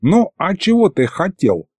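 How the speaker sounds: high-pitched, lightly boastful; mildly self-satisfied old man; proud grandfather. A narrator's reading. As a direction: puff yourself up a little. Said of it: "горделиво приосанился дед".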